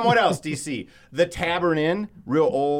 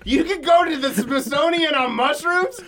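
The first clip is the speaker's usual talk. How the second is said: high, laughing amazed question; retelling the dispensary moment, giddily amazed; giddy disbelief; 11 semitones higher, 4 dB louder than usual. Retold or imitated. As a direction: ask it half laughing with delight. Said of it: "I was like".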